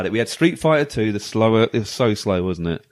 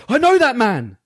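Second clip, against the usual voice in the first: startled realisation; 11 semitones higher, 4 dB louder, slower than usual. Retold or imitated. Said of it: "I was like".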